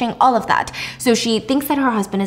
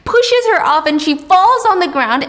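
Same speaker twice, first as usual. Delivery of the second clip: ordinary talk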